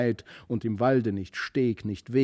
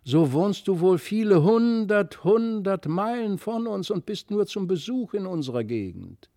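weinerlich; 9 semitones higher, 6 dB louder than usual. A narrator's reading. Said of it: "rief Christlieb weinerlich aus".

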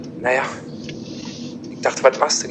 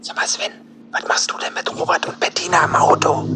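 whispering. Acted. Secretly asks about car passengers.

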